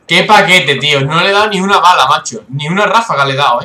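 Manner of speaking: loud, exasperated gamer complaint; exasperated protest. Real, not acted. Player who missed every bullet, loud exasperated protest. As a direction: protest loudly in disbelief at your own misses.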